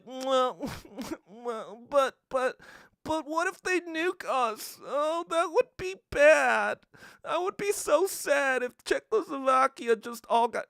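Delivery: hammed-up worried whine; mocking timid whine. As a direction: whimper it like a scared hand-wringer. Mocking timid Western communists.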